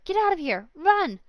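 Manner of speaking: high urgent cry. Acted. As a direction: urge him to flee. Girl frees the fawn.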